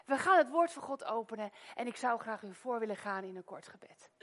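normal talking voice

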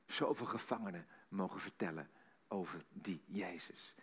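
low, soft storytelling that nearly sinks to a whisper; hushed, tender testimony. Retold or imitated. Preacher retells Sister Tong's prison memory of Jesus, hushed and low. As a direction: draw listeners in with a reverent hush.

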